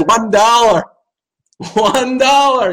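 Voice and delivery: high, odd voice, "dollar" dropped; mock judge announcing the award. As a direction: laugh through a mock verdict. Mock judge verdict, laughing.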